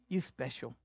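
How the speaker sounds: soft, low and hushed, like a loving father; tender whisper of God. Acted. Preacher voicing God to his church, soft and tender. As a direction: lean in and say it gently. God's words voiced tenderly.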